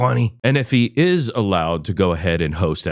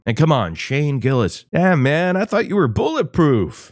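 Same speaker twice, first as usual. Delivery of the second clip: normal talking voice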